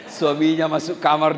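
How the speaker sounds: normal talking voice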